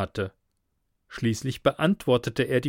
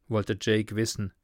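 ordinary delivery

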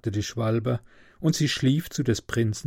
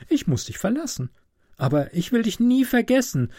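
high-pitched, lilting swallow voice; affectionate swallow, longing; 6 semitones higher than usual. Acted. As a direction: speak lightly up high as the little swallow. (schwärmende Schwalbe mit hoher Stimme)

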